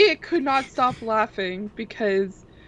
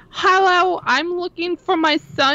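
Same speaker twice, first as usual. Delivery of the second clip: imitation; 7 semitones higher than usual. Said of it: "he's just like".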